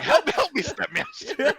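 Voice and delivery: very high, loud laughing cat cry; squeaky cat voice. Pretend. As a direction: squeal for help like a cartoon cat. Playing Palico cat voice.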